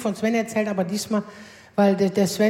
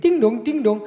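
ding-dong doorbell imitation. Tells courier how to ring, sung ding dong. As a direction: sing the two bell tones, falling. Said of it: "aber Sie klingeln doppelt".

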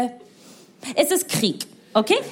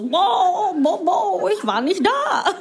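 higher, listless mock politician mumble; limp boiled fish Scholz; 8 semitones higher, much slower than usual. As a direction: play a boiled fish of a man. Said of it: "Energie von so einem gekochten Fisch".

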